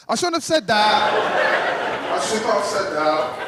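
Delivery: chuckling through the words, slow at first; laughing. Real, not acted. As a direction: laugh as you admit it. Laughing at own joke.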